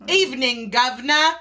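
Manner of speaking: high, hammed-up British "Evening, Governor"; over-the-top posh British greeting; mock British accent. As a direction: play a cartoon English gent. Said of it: "We better work on our accents".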